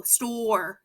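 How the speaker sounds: "store" with a long O and the E unheard; teacher sounds out "store" softly for young readers; bossy E store. Shown as a demonstration. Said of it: "that bossy E that makes it say O".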